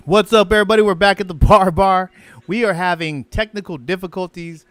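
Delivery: loud upbeat show intro; radio host. Acted. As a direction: greet the listeners like a DJ.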